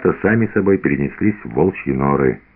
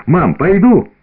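put-on eager child asking; 10 semitones higher, 4 dB louder, slower than usual. Boy asks mother eagerly.

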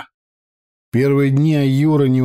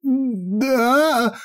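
wheezed; 12 semitones higher than usual. A narrator's reading. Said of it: "просипел распорядитель".

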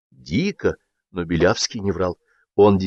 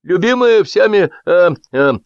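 high, loud, drawn-out hesitation; pompous speaker stuck mid-phrase; faltering oratory; 9 semitones higher, 5 dB louder than usual. A narrator's reading. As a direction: stall grandly while hunting for words. Orator stuck for the next words.

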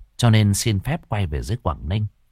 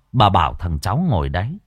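ordinary delivery, nothing marked

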